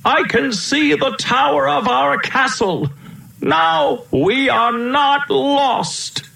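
put-on very high, elated young prince; excited cry. A narrator's reading. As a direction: sing out the good news, stretching the last words. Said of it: "he cried".

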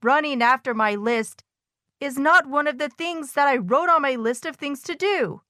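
understated storybook lament; mild wail. A narrator's reading. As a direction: wail lightly in protest. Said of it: "wailed Toad".

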